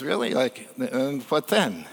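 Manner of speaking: underplay it against the big pitch; unimpressed. Retold or imitated. Content fisherman answering the Harvard grad, unimpressed.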